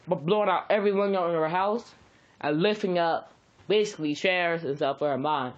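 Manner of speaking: plain reading voice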